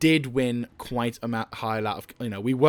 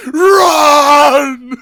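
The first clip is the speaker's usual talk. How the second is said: much higher and louder yelled command; triumphant yell; 13 semitones higher, 15 dB louder than usual. Pretend. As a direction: scream it after the fleeing enemy. Yelling at fleeing enemy.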